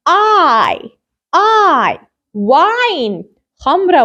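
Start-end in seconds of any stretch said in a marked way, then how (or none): 0.1-2.0 "I" stretched out as the letter's name; long I. Shown as a demonstration. Teacher shows the vowel magic E makes, long I. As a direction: draw out "I" as a long "eye". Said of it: "I pronounce it as I".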